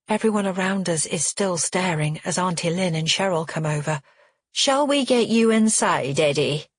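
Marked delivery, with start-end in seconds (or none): 4.5-6.7 sharp, falsely soothing aunt; higher, sugary but sharp aunt's voice; patronizing soothing. Acted. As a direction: coax him like a child, with an edge. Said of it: "trying to sound soothing".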